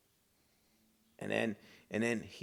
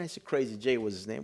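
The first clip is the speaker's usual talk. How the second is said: ordinary delivery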